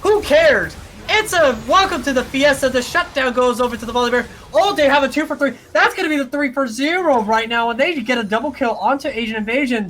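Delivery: high, loud esports shoutcasting; excited play-by-play. Real, not acted. Caster calling a chaotic fight to viewers, excited play-by-play. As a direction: call the team fight at full excitement. Live play-by-play at full force.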